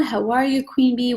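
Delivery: usual speaking voice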